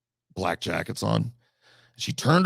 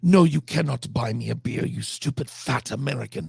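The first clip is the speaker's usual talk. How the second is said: rude North Korean woman; 3 semitones higher than usual. Retold or imitated. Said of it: "And she was like".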